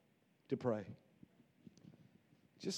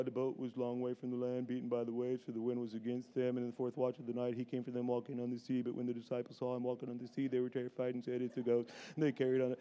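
low, monotone bored drone; a dull Bible reading, flat and lifeless; boring reading; 8 semitones lower, 12 dB quieter than usual. Shown as a demonstration. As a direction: read it like it puts you to sleep. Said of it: "you read it".

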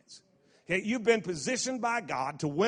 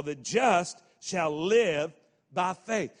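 slow, weighty recital; slow emphatic recital; 4 dB louder, much slower than usual. Shown as a demonstration. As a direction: lay each word down slowly. Scripture recital.

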